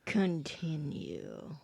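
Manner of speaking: slow, soft, drawn-out word; hushed, stretched-out cue; quiet prompt. Retold or imitated. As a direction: draw it out softly. Imitating own prompt to co-host.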